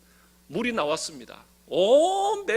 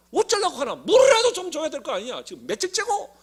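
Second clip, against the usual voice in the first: higher, louder whiny griping crowd; grumbling complaint; 6 semitones higher, 4 dB louder than usual. Retold or imitated. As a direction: gripe at Moses like a thirsty mob. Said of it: "이러면서 원망하는 거예요". (목말라 모세에게 원망하는 백성, 높고 큰 투덜거림)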